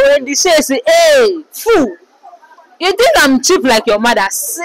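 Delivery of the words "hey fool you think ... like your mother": mock furious insult. Celeb insults someone.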